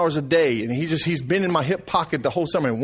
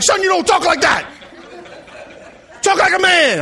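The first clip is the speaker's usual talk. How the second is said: higher and louder, a firm fatherly reprimand; retold father's rebuke, raised and firm; stern dad scolding; 10 semitones higher, 4 dB louder than usual. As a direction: lay down the law to your son. Father scolding son's speech.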